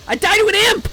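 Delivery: high furious yell; outraged shout. Real, not acted. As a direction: scream in frustration at dying. Dying in the game.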